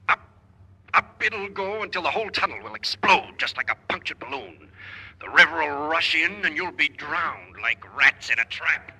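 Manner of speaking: gloating menace. Acted. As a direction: relish the threat as it builds. Villain gloats about the explosion.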